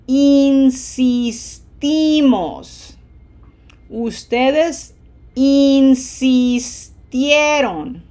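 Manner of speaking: teacher drilling stressed endings for the class; syllables with emphasis. Shown as a demonstration. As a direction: lean hard on the stressed ending of each form. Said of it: "syllables with emphasis".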